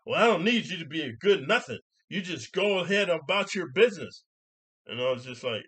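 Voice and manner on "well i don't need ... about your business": loud, high, scolding retort; imitating her yell. Lady rebuffing the man's apology, mock yelling. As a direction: shut him down as the irate lady. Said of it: "this is what she yells to me".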